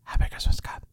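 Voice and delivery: soft whispered wish; whispering. Shown as a demonstration. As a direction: whisper it softly. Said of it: "Can we stop whispering?".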